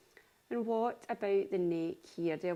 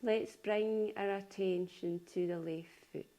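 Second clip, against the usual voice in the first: unhurried yoga nidra voice, long pauses between phrases; calm body-scan delivery, spaced out phrase by phrase; guided meditation. Acted. Leads a guided relaxation.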